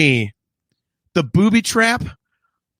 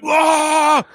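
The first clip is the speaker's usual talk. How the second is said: pained yelp; 10 semitones higher than usual. Retold or imitated. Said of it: "And he's like".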